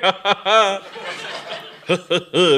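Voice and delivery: high jolly chuckle; hearty laugh. Acted. Bishop laughs.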